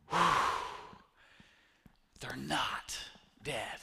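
hushed whisper of relief; relieved whisper. Retold or imitated. Speaker imitates the man whispering in relief. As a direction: breathe it out like a weight lifted. Man waking from imagined grief.

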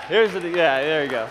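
normal talking voice

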